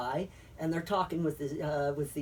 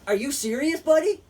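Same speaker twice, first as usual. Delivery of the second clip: incredulous; 9 semitones higher, 8 dB louder than usual. Retold or imitated. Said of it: "they're like".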